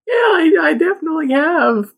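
high-pitched, put-on bluff; unconvincing boast. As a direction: squeak out a badly faked claim to experience. Voices young unicorn lying to friends.

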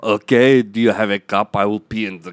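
put-on insistent Austrian accent; Arnold Schwarzenegger. Guest offers proof of sobriety, Austrian accent. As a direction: push back in a thick Austrian accent. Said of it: "Arnold Schwarzenegger, come out".

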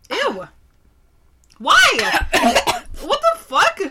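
high, loud shocked disgust; disgusted surprise. Real, not acted. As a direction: recoil from a sour taste. First sip of very sour beer.

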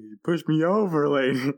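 a hammed-up, high, offended whine; mock whiny motorcycle. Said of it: "It's like".

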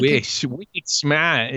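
slow, loose Boston imitation; exaggerated Boston drawl; Boston accent. Shown as a demonstration. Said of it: "just kinda loose way to talk".

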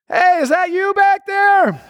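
high, slow call over the shoulder; calling back. Pretend. Pastor calling back to a lagging supporter, high slow call. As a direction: call out to someone far behind you.

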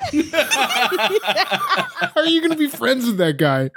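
high, slow laughing question; laughing, high and drawn out. Real, not acted. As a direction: tease through laughter. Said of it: "teasing question".

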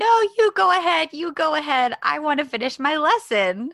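insistent refusal. Retold or imitated. Co-host retells her refusing to leave the pool, insistent. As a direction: wave him off insistently.

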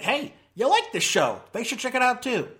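ordinary delivery

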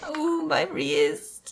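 slow, high mock-crying whine; hammed-up pained moan; pathetic whimper. Co-host imitating the handcuffed suspect, pathetic drawn-out whimper. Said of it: "really pathetic about it".

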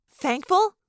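high, questioning disbelief; incredulous echo. Acted. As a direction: throw the word back high, as a question. Girl echoes mom in disbelief.

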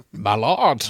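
a short, mock-statesman call; Winston Churchill. Shown as a demonstration. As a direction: put on a grand wartime-leader voice. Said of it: "do a Churchill impression".

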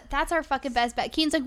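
ordinary delivery

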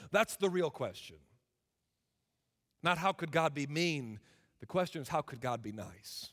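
normal talking voice

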